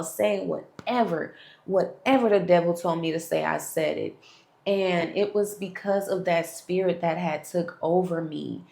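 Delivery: normal talking voice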